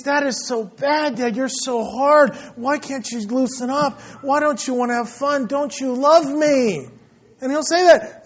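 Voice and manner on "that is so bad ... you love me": imitated bummed-out child. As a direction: protest to Dad like a let-down kid. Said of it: "He's so bummed out".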